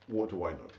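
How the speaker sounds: a resigned aside, said low and soft; muttered to self. Real, not acted. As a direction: mutter it under your breath. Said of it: "repeated rhetorical phrase".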